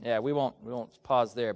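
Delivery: ordinary talk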